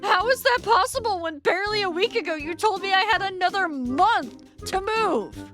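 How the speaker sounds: high-pitched, indignant questioning; incredulous protest. Acted. Tenant challenges landlord over demolition date, incredulous protest. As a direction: challenge him, voice climbing in disbelief.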